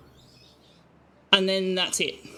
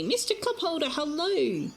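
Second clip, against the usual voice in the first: high sing-song hello to a pet; talking sweetly to her cat; cooing greeting; 8 semitones higher than usual. Real, not acted.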